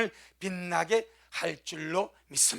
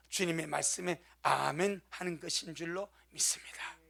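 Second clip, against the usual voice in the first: ordinary talk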